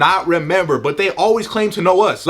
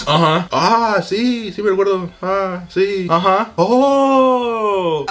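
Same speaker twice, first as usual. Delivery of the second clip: awkward fake agreement; much slower than usual. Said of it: "looking like".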